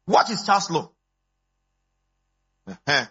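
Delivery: usual speaking voice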